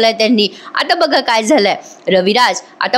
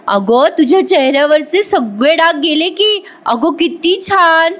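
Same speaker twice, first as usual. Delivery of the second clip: delighted praise; 6 semitones higher than usual. A narrator's reading. Kalpana delighted at Pratima's face.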